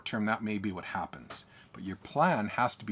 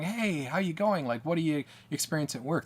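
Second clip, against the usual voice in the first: light, higher friendly greeting voice; cheery friend asking; 6 semitones higher than usual. Retold or imitated. Friends at a party greeting the apprentice, higher and cheerful. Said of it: "their friends are going".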